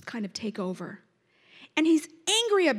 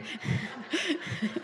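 soft embarrassed laugh; a quiet laugh at herself; 8 dB quieter than usual. Real, not acted. Preacher laughing at herself after snorting, soft laugh.

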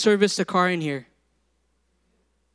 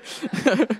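brief chuckle after teasing; laugh; 4 semitones higher than usual. Real, not acted. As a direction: laugh off your own joke. Laughs after joking with congregation.

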